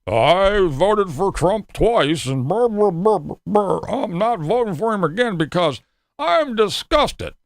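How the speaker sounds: slow sarcastic voter impression; mock voice of a disillusioned voter; mocking a TV Trump voter. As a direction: mimic the ex-supporter with disdain. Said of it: "I hear all this shit on the T V".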